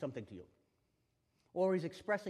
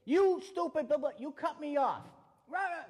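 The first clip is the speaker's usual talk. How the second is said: loud, high mock ranting driver; mock angry rant; 12 semitones higher, 9 dB louder than usual. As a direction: ham up an enraged driver yelling. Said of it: "You say".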